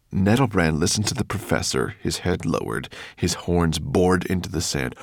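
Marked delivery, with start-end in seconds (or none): none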